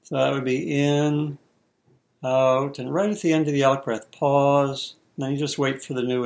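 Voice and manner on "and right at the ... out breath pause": ordinary talk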